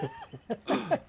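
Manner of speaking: sharp pretend gasp; mock gasp. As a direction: gasp in fake alarm. Fakes deleting the movie.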